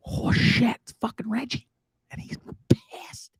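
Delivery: hushed, breathless whisper. Retold or imitated. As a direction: whisper so he can't hear you inside. Hiding from angry neighbor at door.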